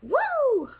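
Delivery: a leaping high woo, mock amazement; startled woo. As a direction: react like a fast talker just blew past you. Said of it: "you're like".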